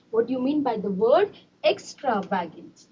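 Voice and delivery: teacher quietly repeating a question to the class. Shown as a demonstration. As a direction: drop the voice and ask the class again.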